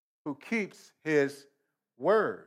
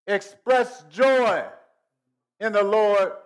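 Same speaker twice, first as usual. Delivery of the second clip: preached emphasis; 4 semitones higher, 7 dB louder than usual. Acted. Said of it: "preaching build".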